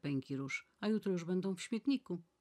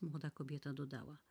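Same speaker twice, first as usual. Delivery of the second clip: plain reading voice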